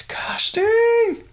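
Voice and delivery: high-pitched, astonished exclamation; real astonishment, pitched high; amazed outburst. Real, not acted. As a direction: let it jump way up in disbelief. Shocked reaction to arrest count.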